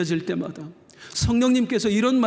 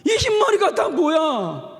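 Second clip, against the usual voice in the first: much higher, louder tearful cry; 10 semitones higher, 4 dB louder than usual. Retold or imitated. Niece hugging her aunt in tears, loud tearful crying out. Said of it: "펑펑 우는데".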